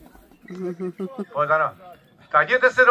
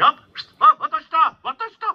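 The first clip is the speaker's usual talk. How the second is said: high, loud call to a bird in flight; calling the falcon; 9 semitones higher, 4 dB louder than usual. Real, not acted. Falconer calling his falcon to turn, high and loud. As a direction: call it round, sharp and high.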